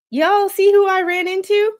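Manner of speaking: high, loud gleeful gossip; excited gossip. Retold or imitated. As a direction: announce the sighting with glee. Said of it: "text it to Mister Squid".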